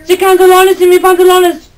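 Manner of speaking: high squeaky Spanish voice; bad guy speaking Spanish. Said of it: "He's speaking Spanish!".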